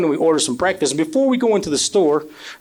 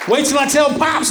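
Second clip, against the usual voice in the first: mom threatening; 9 semitones higher than usual. Retold or imitated. Mom threatening to tell dad.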